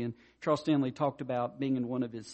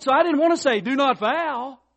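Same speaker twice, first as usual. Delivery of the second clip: high and loud, slowing down on do not foul; emphatic coach; 10 semitones higher, 9 dB louder than usual. Retold or imitated. Coach's quote, loud then slow.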